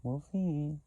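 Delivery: high, slow, sing-song taunting; mocking smug voice. Mimicking the people stalking her online, high and slow smug taunt. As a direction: drag it out like a smug watcher. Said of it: "And you're like".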